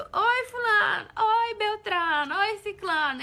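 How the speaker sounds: high, loud, slow singsong greetings; slow, high, singsong roll-call of hellos; mocking live greetings. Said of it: "porque aqui é muito".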